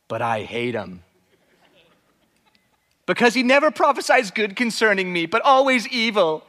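a hint of a sulky baby king complaining; whiny king. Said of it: "I'm just kind of putting that tone to his voice".

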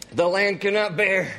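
half-hearted courtroom Nicholson; bad Jack Nicholson. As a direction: Amaziah on the stand, faintly Nicholson. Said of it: "really bad Jack Nicholson impersonation".

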